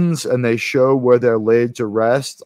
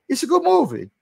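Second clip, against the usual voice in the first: much higher, put-on voice for the line; mock high-pitched voice; 12 semitones higher, faster than usual. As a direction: squeak it out in a silly character voice. Repeats line in new voice.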